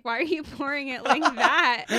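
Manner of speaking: playfully indignant challenge; imitated complaint. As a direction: imitate someone criticizing your pour. Acts out partner's complaint.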